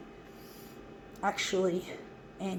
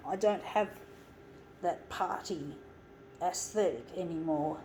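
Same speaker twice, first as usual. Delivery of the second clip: normal talking voice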